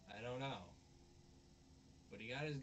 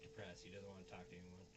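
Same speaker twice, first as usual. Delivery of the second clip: usual speaking voice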